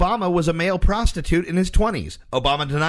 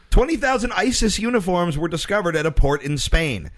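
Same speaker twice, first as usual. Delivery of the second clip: ordinary talk, nothing marked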